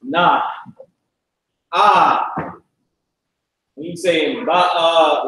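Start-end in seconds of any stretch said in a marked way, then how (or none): 1.7-2.5 AH as a long, open syllable; accented AH. Shown as a demonstration. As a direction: say AH drawn out, pitch arching up and down. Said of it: "we got accents on ours".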